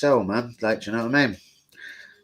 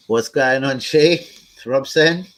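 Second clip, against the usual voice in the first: excited greeting; 3 semitones higher, 7 dB louder, slower than usual. Real, not acted. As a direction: hail viewers by name, pitched up. Greeting chat names loudly.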